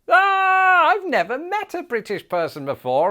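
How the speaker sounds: high-pitched gushing, opening on a loud squeal; mock excitement. American employer meeting a British person, hammed-up excitement. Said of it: "would usually be one of excitement".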